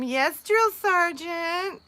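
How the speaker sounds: high, very drawn-out mock obedience; teasing swoon. Teasing coworker about servicing his room, mock swooning. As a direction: tease her with a slow, lilting imitation. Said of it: "she's laughing and joking".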